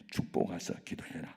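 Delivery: whispered, low inner answer. Retold or imitated. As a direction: breathe it like a voice inside. God's answer heard inwardly.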